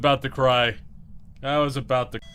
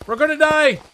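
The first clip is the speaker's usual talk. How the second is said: high panicked repeated cries; panicked shouting; 5 semitones higher, 5 dB louder than usual. Real, not acted. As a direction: yell it in a rising panic. Ghost hunt, chased by ghost.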